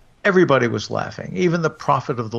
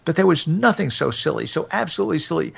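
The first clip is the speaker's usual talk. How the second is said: frantic confession; 9 semitones higher than usual. A narrator's reading. Wicked man frantically confessing, pleading.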